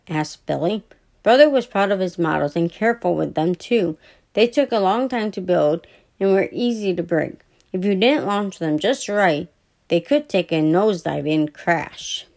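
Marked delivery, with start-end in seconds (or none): none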